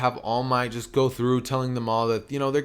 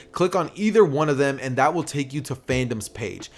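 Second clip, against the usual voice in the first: ordinary delivery, nothing marked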